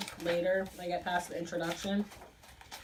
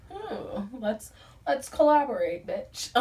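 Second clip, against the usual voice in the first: high, sassy hmm, then louder collab line; mock influencer; 5 semitones higher, 7 dB louder, slower than usual. Mocking herself as an Instagram influencer, sassy put-on. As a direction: play the fake-fancy Instagram star.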